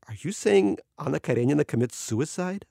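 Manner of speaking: ordinary delivery, nothing marked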